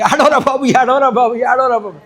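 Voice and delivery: mock pleading with a baby; lightly acted urging parent. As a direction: coax a newborn, lightly acted. Parents urging newborn to cry.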